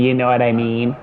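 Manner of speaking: put-on voice for making fun of someone; mocking voice. Co-host showing how people make fun of someone, mocking voice. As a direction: parrot it back in a lightly mocking voice. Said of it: "Yeah, like that".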